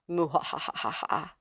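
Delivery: low, soft villain laugh; a quiet cartoon villain cackle; evil cackle.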